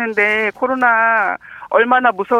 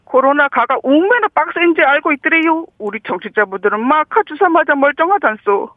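raised, rolling Gangwon lilt; Gangwon dialect demo; 4 semitones higher than usual. Shown as a demonstration. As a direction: rattle it off in full Gangwon dialect. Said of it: "강원도 사투리로 한번 해볼게요".

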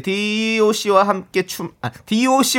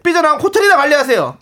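irritated complaint; 8 semitones higher than usual. Acted. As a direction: snap back at a nosy boss. Reading irritated listener complaint.

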